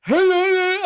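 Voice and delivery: high, loud mock shriek; imitated screaming. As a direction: scream it out in comic panic. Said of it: "I'm screaming".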